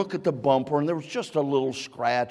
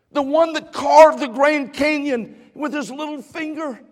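high, loud preaching crescendo; full-force preaching; 10 semitones higher, 7 dB louder than usual. Acted. Preacher voicing God's invitation to the congregation at full force. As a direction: thunder out God's invitation, climbing.

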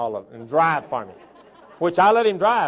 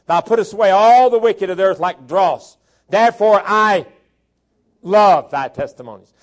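loud, emphatic Bible reading; preaching scripture; 4 semitones higher, 11 dB louder than usual. Acted. Preacher reading verses to a congregation, loud preaching. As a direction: proclaim the verse, leaning hard on key words.

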